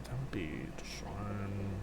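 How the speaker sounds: slow, whispered aside; whispering. Real, not acted. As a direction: whisper it to yourself. GM muttering aside while checking rules.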